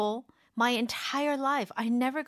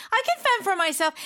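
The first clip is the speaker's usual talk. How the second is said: higher, quick and a little louder; defiant self-reliance; 8 semitones higher, 4 dB louder, faster than usual. Shown as a demonstration. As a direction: rattle it off, proud and independent. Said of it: "that's the intonation that I hear".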